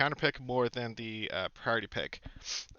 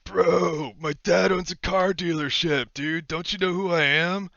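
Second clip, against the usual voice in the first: higher, louder entitled frat-bro voice; 5 semitones higher, 6 dB louder than usual. Pretend. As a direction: play a bratty rich frat boy. Mock rich-kid brag after chug.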